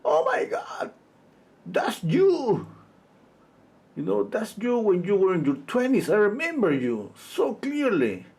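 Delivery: high, shrieking squeal of recognition; a friend shrieking with delight; excited scream. Retold or imitated. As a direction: squeal it out like you can't believe it. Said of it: "she screamed like".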